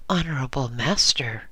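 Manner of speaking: plain reading voice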